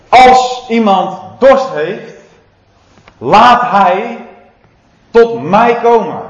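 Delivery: loud, slow proclaiming cry; Jesus calling out. Retold or imitated. Preacher voicing Jesus calling to the temple crowd, loud cry. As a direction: call it out over the crowd, slowly. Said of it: "En die riep dit".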